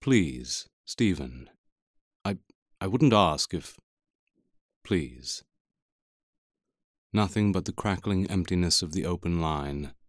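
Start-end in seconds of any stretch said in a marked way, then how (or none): 0.0-5.4 shaking voice. A narrator's reading. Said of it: "My voice shook as I said".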